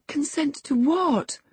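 high, slow, concerned question; alarmed. A narrator's reading. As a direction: ask anxiously, moved by his distress. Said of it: "she grew thoroughly moved and alarmed".

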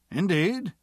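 rising, surprised "Indeed?"; surprised question. Acted. A surprised reply.

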